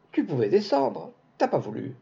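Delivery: put-on mild child's outcry; child's cry. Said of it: "La petite cria".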